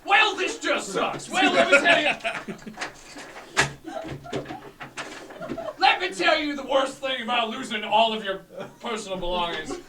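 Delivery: hammed-up grievance about losing everything; mock rant. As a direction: potion seller complains to the party, loud and hammed-up. Seller rants about loss.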